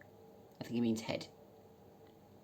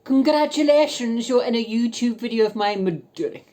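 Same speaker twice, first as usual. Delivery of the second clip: mocking announcement; 10 semitones higher, 11 dB louder than usual. Voice note to the man who ignored her, loud mock fanfare. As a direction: announce it like a prize winner, mocking.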